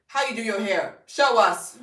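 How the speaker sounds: mock insistent demand; pushy demand. People pressuring her about her hair, pushy.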